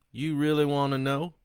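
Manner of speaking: loud, high, sneering convict; defiant killer taunting. Acted. Killer Sloan taunting the priest, loud and sneering.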